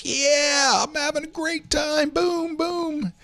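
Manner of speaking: party excitement. Retold or imitated. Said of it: "I was like".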